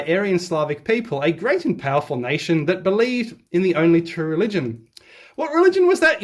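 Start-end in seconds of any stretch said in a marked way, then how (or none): 5.4-6.2 mock curious. Mock rhetorical question.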